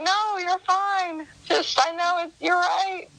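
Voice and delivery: eager reassurance. Retold or imitated. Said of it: "I'm like".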